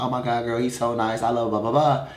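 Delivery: mock gushing, tailing into blahs; gushing mother. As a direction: mimic her raving to a friend. Mother gushing to friend by phone.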